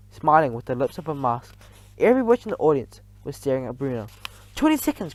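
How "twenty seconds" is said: high, sharp countdown; witch's countdown cry. A narrator's reading. Grand High Witch counting down to the witches, high cry. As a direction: call out the time gleefully. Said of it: "cried the Grand High Witch".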